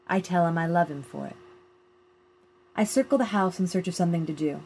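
usual speaking voice

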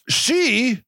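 ordinary talk, nothing marked

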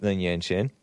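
ordinary talk

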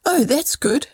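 put-on a touch of boyish delight; mild exclamation. A narrator's reading. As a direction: exclaim lightly, as a pleased boy in a story. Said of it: "exclaimed Bob".

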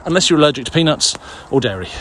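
ordinary talk, nothing marked

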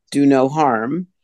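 ordinary talk, nothing marked

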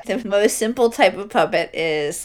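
normal talking voice

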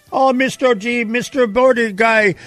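high-pitched pleading mock migrant; sorry migrant imitation. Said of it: "my sorry, uh, uh, imitation of a, of a migrant".

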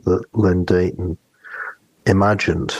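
ordinary talk, nothing marked

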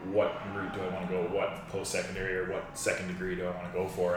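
ordinary talk, nothing marked

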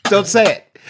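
loud, high-pitched flustered protest; retold frantic plea. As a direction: plead in a mock panic to stop. Retelling dad's panicked plea to child.

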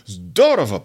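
zdorowo stressed on its opening syllable; stress on first syllable. Shown as a demonstration. Teacher saying he sings great in Russian, first-syllable stress. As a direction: hit "zdo" in zdorowo. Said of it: "accent on that first syllable".

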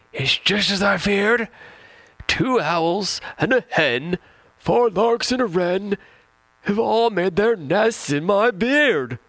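put-on high, slow, loud old man's wail; over-the-top high-pitched old man complaining; with emphasis. Shown as a demonstration. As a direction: ham up the old man's alarm in a high squeaky voice. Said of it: "one more time with emphasis".

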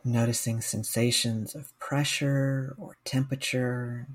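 slow, calm meditation guide with long pauses; guided meditation. Real, not acted. Mindfulness coach guiding listeners through a body scan, guided meditation. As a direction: guide listeners gently, leaving space after each phrase.

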